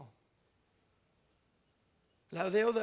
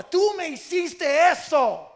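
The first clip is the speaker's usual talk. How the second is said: slow, loud, higher accusing voice; accusing quote; 8 semitones higher, 13 dB louder, slower than usual. Retold or imitated. Resentful partner throwing the old wrong in their face, loud and slow. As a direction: spit out the grudge like a wronged partner.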